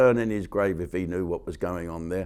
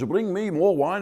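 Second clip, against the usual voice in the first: higher mock Dutch accent, rising at the end; mock Dutch person; 8 semitones higher than usual. Comic shows how a Dutch impression would sound, in a jokey Dutch accent. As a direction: toss the line off as a jokey Dutch tourist. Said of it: "an impression of a Dutch person".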